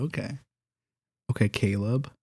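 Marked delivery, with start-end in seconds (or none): none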